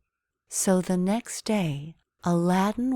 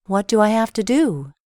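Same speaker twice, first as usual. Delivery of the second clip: higher, louder eager question; 4 semitones higher, 7 dB louder than usual. A narrator's reading. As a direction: ask it brightly and eagerly. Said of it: "Aladdin replied happily".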